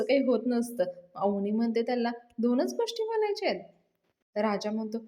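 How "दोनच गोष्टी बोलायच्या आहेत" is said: high-pitched questioning disbelief; surprised, teasing query; mock incredulity. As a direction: question it with a big lift in pitch. Avni questions राजा's request in retold scene.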